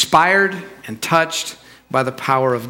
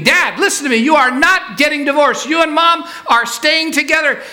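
high-pitched, emphatic scolding; outraged daughter, voice pitched way up; screaming at dad; 11 semitones higher than usual. Acted. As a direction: lay down the law in a hammed-up near-scream. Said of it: "starts screaming".

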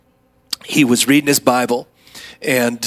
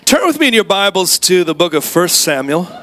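normal talking voice

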